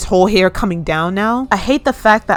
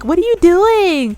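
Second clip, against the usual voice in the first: much higher squeaky pet voice; cat's voice; 11 semitones higher than usual. Retold or imitated. Her cat on her lap asking what she's doing, squeaky high voice. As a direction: voice the cat's baffled question up high. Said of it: "She's, like".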